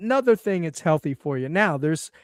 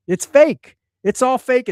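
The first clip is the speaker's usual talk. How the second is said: raised, louder declaration; emphatic outburst; 5 semitones higher, 6 dB louder than usual. Real, not acted. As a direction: call it out as fake, hard. Ranting against fake processed food.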